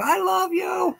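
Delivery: very high-pitched mock kid squeal; imitating little kids. Rooster copying kids at the store, squealed kid imitation. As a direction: squeal it high like a child cooing at chicks. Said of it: "imitating all those little kids".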